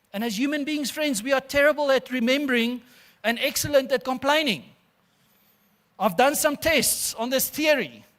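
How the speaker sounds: loud, high and driving sermon voice; preaching at full force. Acted. Pastor rousing the congregation about complaining, loud preaching. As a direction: preach it out loud to the whole church.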